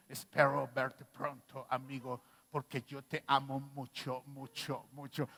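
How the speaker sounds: low, slow, drawn-out loving voice that fades away; imitating Cletus. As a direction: friend gushing love in a voice message, low and slow. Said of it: "Le va a llegar mi imitación a Cletus".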